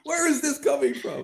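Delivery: high laughing question. Real, not acted. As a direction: ask it through a laugh. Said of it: "laughing question".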